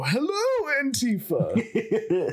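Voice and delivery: high, drawn-out Joker voice; mock Joker voice, slow and high. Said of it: "dress up in Joker makeup".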